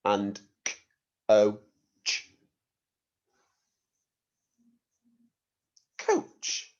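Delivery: k o ch split into sounds, then Coach whispered; teacher sounding out Coach; Fred Talk and blend. Shown as a demonstration. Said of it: "I'll say it in Fred Talk".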